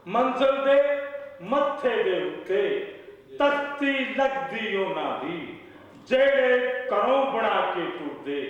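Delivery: high-pitched declaimed Punjabi verse; poetry recital. Acted. Poet reciting couplets at a mushaira, declaimed recital. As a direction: recite phrase by phrase, repeating lines for the audience.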